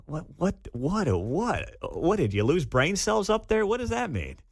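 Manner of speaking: incredulous, stammered questioning; baffled disbelief. Real, not acted. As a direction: sputter in mocking bewilderment. Baffled by the played quote.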